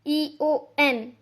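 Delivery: ईओएन spelled very slowly, letter by letter; spelling out letters. Shown as a demonstration. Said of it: "जैसे".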